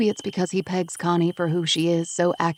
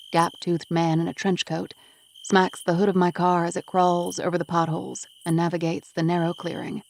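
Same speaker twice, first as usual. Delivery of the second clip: plain reading voice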